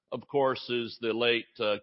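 ordinary talk, nothing marked

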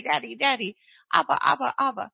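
put-on insistent, repeated calling in a childlike voice; child calling daddy. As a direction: imitate a little child crying out for daddy. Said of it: "That's, that's the voice that we cry out to God with".

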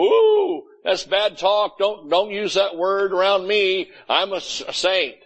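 high "ooh", then scolding in mock offense; mock shocked saint. Preacher imitating Pentecostals hearing a cuss word, mock-shocked. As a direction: imitate a scandalized churchgoer. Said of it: "they'd go".

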